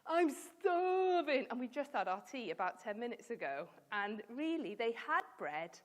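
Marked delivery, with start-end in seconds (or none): 0.1-1.5 high, loud, very drawn-out moan; exaggerated hunger complaint. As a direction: overdramatic stretched wail. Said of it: "bit like when we say".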